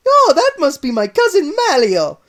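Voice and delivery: high, loud Italian play-dumb; Mario deflects blame, loud innocent Italian. Acted. Mario dodges.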